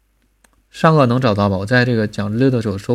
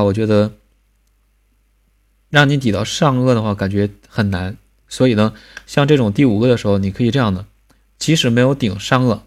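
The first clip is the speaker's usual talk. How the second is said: ordinary talk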